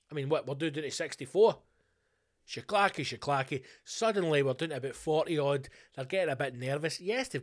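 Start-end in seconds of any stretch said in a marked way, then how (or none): 2.5-3.6 mock fight noise. Imitating horse kicking ducks.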